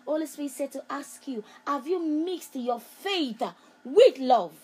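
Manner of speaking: loud prophetic call with rising pitch; loud prophetic preaching. Acted. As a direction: call her out by name and press the question. Prophetic word to Angela.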